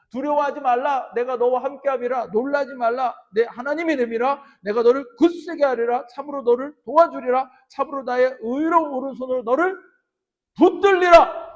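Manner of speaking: loud declaimed Bible verse, surging on the last word; forceful scripture reading. Acted. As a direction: proclaim the promise to the congregation. (성경 말씀을 힘차게 선포하듯 낭독)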